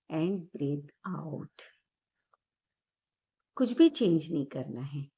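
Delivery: calm guided breathing meditation voice. Acted. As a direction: ease the listener into calm, gently. Leads guided meditation after intro.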